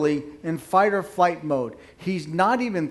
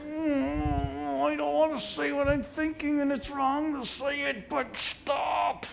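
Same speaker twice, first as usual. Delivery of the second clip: high, soft, strained whine; pitched-up tearful begging; mock whimpering; 8 semitones higher, 4 dB quieter, slower than usual. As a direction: plead as if near tears, hammed-up. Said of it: "Is that vivid enough?".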